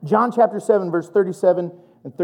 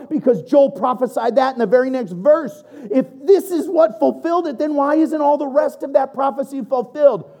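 sassy; 7 semitones higher than usual. Real, not acted. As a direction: challenge them with a sassy edge. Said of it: "I get a little sassy".

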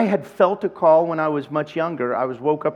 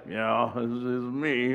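sheepish admission; 4 semitones lower, 6 dB quieter, slower than usual. Retold or imitated. Humble reply to old acquaintance.

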